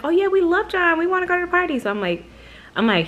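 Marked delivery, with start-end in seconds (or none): 0.0-1.8 mocking relatives. Mocking relatives eager for party.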